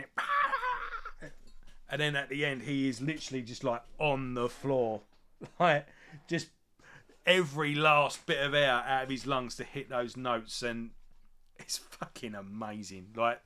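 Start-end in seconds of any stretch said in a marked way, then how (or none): 0.0-1.3 imitating a high note. Imitating singer's big note. 11.6-13.1 slow, breathless whispered awe; awed whisper. Real, not acted. Awed aside about the singer.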